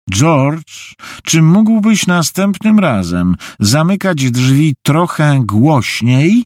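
wyższy głos matki, z przekąsem; sarkastyczne upomnienie. A narrator's reading. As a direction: upominaj z góry, przeciągając końcówki. (sarkastyczna nagana matki)

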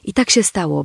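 usual speaking voice

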